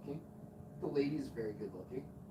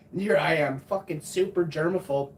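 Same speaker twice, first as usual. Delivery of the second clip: ordinary talk, nothing marked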